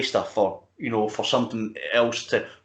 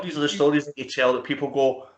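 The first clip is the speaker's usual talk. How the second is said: ordinary talk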